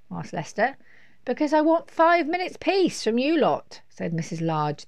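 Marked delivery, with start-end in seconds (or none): 1.2-3.8 high, loud exasperated insistence; fed-up mum. A narrator's reading. Mum has had enough of the children, loud exasperation. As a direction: insist loudly, voice pitched up.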